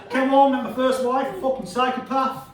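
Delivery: usual speaking voice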